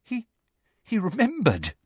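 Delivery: high, halting stammer; small high shocked voice; frightened amazement. A narrator's reading. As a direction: stammer it, near fainting. Frightened girl about to faint.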